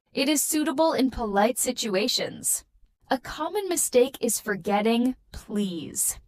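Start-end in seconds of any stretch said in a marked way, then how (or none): none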